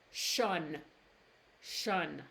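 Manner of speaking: tion said as shun, no T sound. Shown as a demonstration. Teacher showing tion is said shun. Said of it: "T I O N is pronounced as shun".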